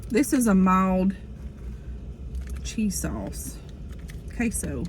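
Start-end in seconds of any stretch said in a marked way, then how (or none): 2.6-4.8 whispering. Real, not acted. Whispers the name of the food.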